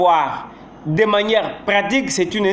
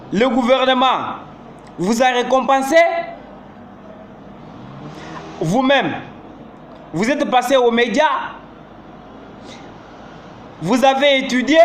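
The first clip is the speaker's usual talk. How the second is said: each question ends on a rising pitch; 3 semitones higher than usual. Shown as a demonstration. Teacher listing rising yes-no questions to students. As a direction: lift the end of every question. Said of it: "ça s'appelle l'interrogation totale".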